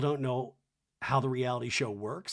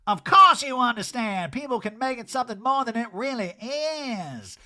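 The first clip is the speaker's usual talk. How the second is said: mock snap; 8 semitones higher, 6 dB louder than usual. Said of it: "Erica snaps".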